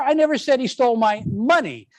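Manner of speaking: money punched, rest plain; stress on MONEY. Shown as a demonstration. Teacher showing it was something else, stress on money. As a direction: lean on money and let the line fall. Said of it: "by the way you emphasize".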